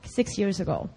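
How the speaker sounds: ordinary delivery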